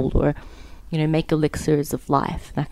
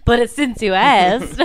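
laughing; 6 semitones higher, 4 dB louder, slower than usual. Real, not acted. Laughing while speaking.

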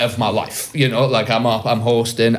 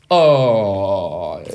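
long drawn-out, doubtful oh; acted reluctance, dragging the vowel; hesitant; 3 semitones lower than usual. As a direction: stall on a stretched-out sound. Hesitating at offer of a lift.

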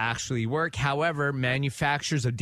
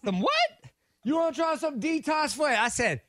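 retold bafflement; 11 semitones higher than usual. Mishearing her from the sink.

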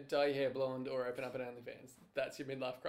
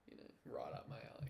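hushed innuendo; whisper; 10 dB quieter, faster than usual. Acted. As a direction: drop to a sly whisper.

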